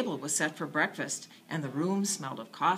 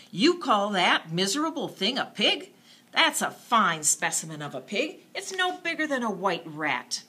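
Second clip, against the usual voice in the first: scoffing; 4 semitones higher, 6 dB louder than usual. A narrator's reading. Brother scoffing at pig.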